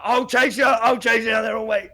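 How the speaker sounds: imitating Dad's yelled threats; mock shouted threat. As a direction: holler threats like an angry dad. Acted threat from yelling dad.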